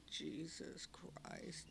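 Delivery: very soft, slow groan of disbelief; barely audible despairing mutter; hushed dismay. Retold or imitated. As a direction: breathe it out in quiet shock. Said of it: "I just thought".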